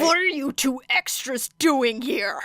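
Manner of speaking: a touch of a snarling rival. A narrator's reading. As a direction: sneer it at the intruders. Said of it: "Bakugo snarled".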